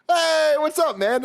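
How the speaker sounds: high bright eager hello; overly friendly greeting. Retold or imitated. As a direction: sound extra nice to a stranger. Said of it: "I'm trying to be nice".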